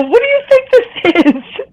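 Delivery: incredulous retort. Retold or imitated. Telling her worried sister she's overreacting, incredulous. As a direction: throw the question back in sheer disbelief.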